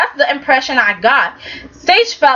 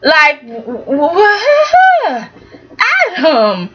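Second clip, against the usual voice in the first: sputtering, laughing stammer; laughing disbelief; 4 semitones higher, much slower than usual. Real, not acted. Vlogger reacting to Adam, laughing in disbelief.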